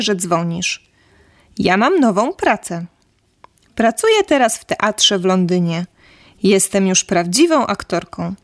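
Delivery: ordinary talk, nothing marked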